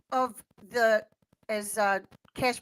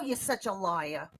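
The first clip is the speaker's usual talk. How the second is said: ordinary talk, nothing marked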